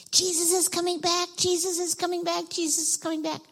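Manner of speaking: high, chanted announcement; calling out. Shown as a demonstration. Demonstrating what children will call out.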